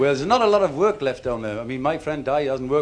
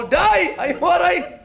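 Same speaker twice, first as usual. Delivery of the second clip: calling down a shaft; 14 semitones higher, slower than usual. Acted. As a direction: shout down the shaft to a fallen mate. Shouting down the pit shaft.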